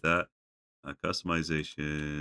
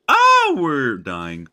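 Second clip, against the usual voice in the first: alarmed shout; 9 semitones higher, 15 dB louder, much slower than usual. Real, not acted. Sudden danger in the game.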